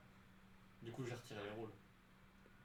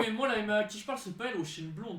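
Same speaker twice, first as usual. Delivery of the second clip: high, raised-voice objection; loud interjection; 9 semitones higher, 10 dB louder than usual. Real, not acted. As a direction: cut in over the others loudly. Cuts in loudly to object. (intervention forte et aiguë)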